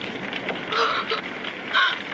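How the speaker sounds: sharp breathy gasp of shock. Acted. Major gasping when the sergeant accuses the girl. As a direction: gasp in disbelief.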